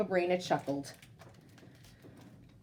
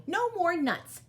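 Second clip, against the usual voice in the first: plain reading voice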